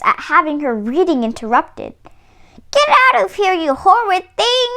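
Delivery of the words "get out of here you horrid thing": a hammed-up angry grandmother; furious granny. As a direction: shoo the wolf out in outrage. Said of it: "she cried".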